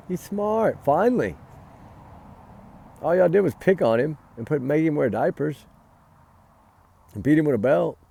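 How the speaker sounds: real anger. Real, not acted. Angry vlogger lashing out at the parents, loud and furious. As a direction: let the outrage burst out at the parents. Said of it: "And that's why I'm mad".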